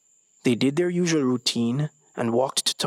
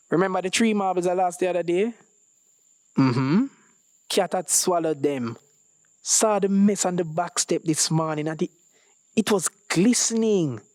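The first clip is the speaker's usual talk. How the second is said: put-on boy voice; 6 semitones higher than usual. Voicing Ezekiel.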